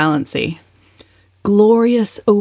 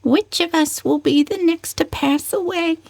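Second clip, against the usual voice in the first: gloomy musing; 11 semitones higher than usual. Acted. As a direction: muse on death in a high, hushed voice. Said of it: "wondered audibly".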